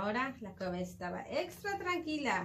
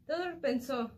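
usual speaking voice

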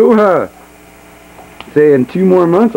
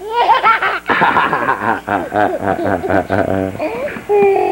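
laughing; 4 semitones lower, 5 dB quieter than usual. Real, not acted. Dad laughs after boo.

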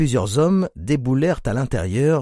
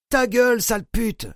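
furious guard; 11 semitones higher than usual. A narrator's reading. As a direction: spit the abuse down at her. Guard insults kneeling prisoner.

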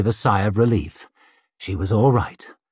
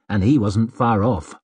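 plain reading voice

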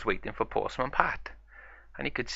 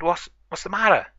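higher, louder startled cry; alarmed pup; 9 semitones higher, 5 dB louder than usual. Acted. Marshall runs out, alarmed, higher voice. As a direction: burst out in toy-play alarm.

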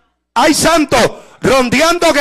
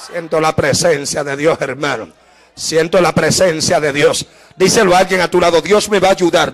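hushed preaching; 10 semitones lower than usual. Real, not acted. Preacher leading the church to repeat that God will help them, hushed and low. Preacher leads congregation in confession.

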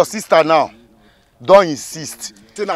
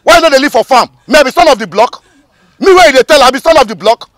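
loud, high indignant comeback; angry retort; 7 semitones higher, 5 dB louder than usual. Acted. Official answering the son-of-the-soil boast, indignant. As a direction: throw it back at them, voice raised. Boasting back in acted quarrel.

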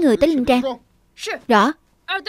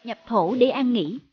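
ritual incantation. Acted. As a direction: intone it like a funeral priest. Funeral rite incantation.

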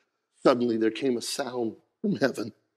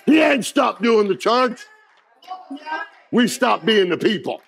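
rising higher and louder into a shout; full-force preaching; 8 semitones higher, 7 dB louder than usual. Acted. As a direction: build the sermon to a roaring peak.

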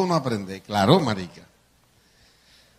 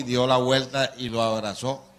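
usual speaking voice

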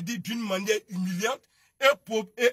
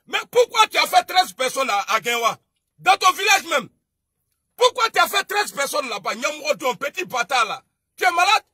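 loud, high demanding shout; angry shout; 3 semitones higher, 6 dB louder than usual. Real, not acted. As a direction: hurl the questions at him. Confronting the other speaker angrily. (interpelle en criant, fort et aigu)

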